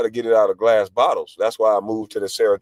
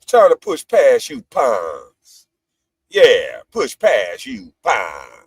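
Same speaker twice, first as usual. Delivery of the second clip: very slow, drawn-out slogan; slow, relishing taunt; savoured catchphrase; much slower than usual. Real, not acted. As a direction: stretch every word, letting PUNKS trail long. Savouring his slogan once more.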